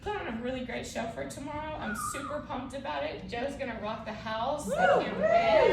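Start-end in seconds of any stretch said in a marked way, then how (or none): 4.7-5.7 much higher, louder burst of excitement; excited. Real, not acted. Bride hyping the wedding party show, excited squeal. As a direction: squeal it out, can't contain it.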